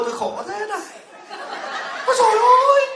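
high, laughing mock wail; mock wailing lament. Monk mocking someone who pities themselves, high laughing mock wail. Mocking a self-pitying complainer.

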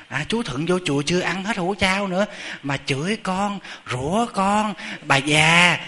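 high-pitched imitation of an aggrieved old woman; tattling complaint. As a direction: play the wronged woman telling on someone to the master. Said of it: "bà lên mét sư ông".

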